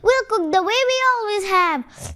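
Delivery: very high, cross cartoon cat voice; squabbling cat voice, slowing on the last words; snapping cat. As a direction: snap at the little duck. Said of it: "snapped the cat".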